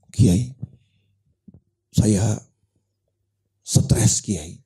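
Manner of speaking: low, halting confession with long pauses; mock-weary plea, trailing off; stressed visitor. Stressed man confiding in his kiai, downcast and halting.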